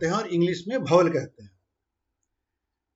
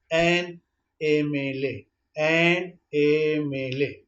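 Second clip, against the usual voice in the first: very slow, letters drawn out; slow motion; 3 semitones lower, much slower than usual. Shown as a demonstration. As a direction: spell it out slowly. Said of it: "तो यहाँ पर भी देखिए".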